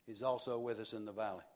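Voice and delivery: whisper-near aside repeat; hushed preacher echo; soft fast echo. Shown as a demonstration. Quiet quick echo.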